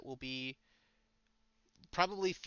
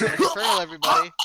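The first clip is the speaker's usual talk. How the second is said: loud announcement; 2 semitones higher, 13 dB louder than usual. Pretend. Announcing joke loudly.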